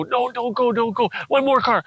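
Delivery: mock a small child's plea to stay; pleading little boy. Little boy begging his dad not to go, pleading.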